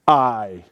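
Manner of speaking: a lone "I", loud and weighty; emphatic I. Shown as a demonstration. Preacher stressing the divine "I" to his hearers, loud and firm. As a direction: land "I" like a divine decree. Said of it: "emphatic, divine".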